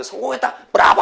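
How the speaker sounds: high, cheering cry of success; triumphant shout. Acted. Experimenters celebrating their success together, triumphant shout. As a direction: shout it out like a breakthrough just happened. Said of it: "하고 외치겠지?".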